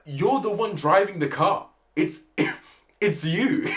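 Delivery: high, loud disbelieving cry breaking into laughter; you can't be serious, shouted through a laugh; exasperated laughing shout. Real, not acted. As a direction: yell it at him, half laughing.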